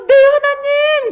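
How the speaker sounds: high, loud, drawn-out answer; obedient call. Acted. Ezekiel lying down answering God, high loud cry. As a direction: call back slowly at full voice.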